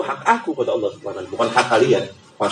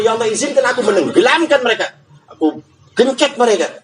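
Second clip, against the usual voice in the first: higher, urgent begging; pleading creation; 7 semitones higher than usual. Acted. Sky begging God to punish mankind, high and urgent. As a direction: plead for permission, pitched up. Earth begs to crush humans.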